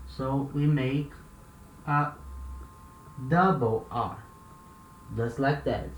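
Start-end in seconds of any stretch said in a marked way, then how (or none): none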